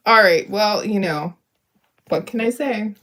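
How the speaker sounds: usual speaking voice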